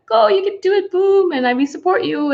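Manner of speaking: high mock cheer; cheering fans. Imitating her supportive viewers, a high cheerful mock cheer. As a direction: chirp like an encouraging crowd. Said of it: "You'd be like".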